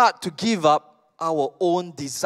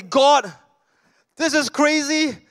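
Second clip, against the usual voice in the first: higher, exasperated protest; frustrated complaint to God; 6 semitones higher than usual. Retold or imitated. A believer complains to God about driving a Grab car, higher and exasperated. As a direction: plead up high, baffled and fed up. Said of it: "we will say".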